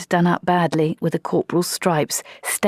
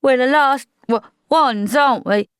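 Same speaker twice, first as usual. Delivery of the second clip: stammering; 8 semitones higher, 5 dB louder than usual. Acted. Wazza stammering the awful realisation.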